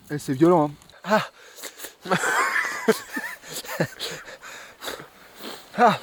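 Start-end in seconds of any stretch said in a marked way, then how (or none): 1.6-4.6 high shrieking laugh through the burn; pained shrieking laugh. Real, not acted. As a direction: laugh out high and strained from the spice. Pain laugh after the spicy bite.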